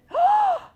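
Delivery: high theatrical gasp; mock gasp. Opponent won over by the chart, gasping in mock amazement. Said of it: "And then they said".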